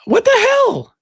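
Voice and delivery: high-pitched disbelief, loud; baffled exclamation. Real, not acted. Host baffled nobody joins stage.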